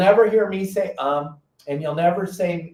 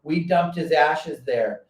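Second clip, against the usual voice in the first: ordinary talk, nothing marked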